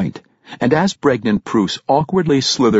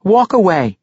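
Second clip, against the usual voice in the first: a higher, tense command to back off; firm warning; 9 semitones higher, 4 dB louder than usual. A narrator's reading. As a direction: warn him off with a raised voice. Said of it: "Regis warned".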